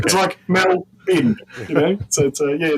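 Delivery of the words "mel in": "Mel in" slowed right down, Melbourne broken into MEL and a short "b'n"; syllable-by-syllable demo. Shown as a demonstration. Australian guest teaching the hosts, slow syllable-by-syllable demo of "Mel in" as MEL-b'n. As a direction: spell out "Mel in" by sound, slow and higher, as MEL... b'n. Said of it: "We just say Melbourne".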